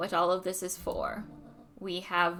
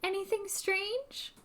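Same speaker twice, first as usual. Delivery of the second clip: higher, slow and soft; different voice; 11 semitones higher, 3 dB quieter, slower than usual. Acted. Reporter asking Luca, higher slower voice. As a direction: try a lighter high voice. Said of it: "I need to do a different voice".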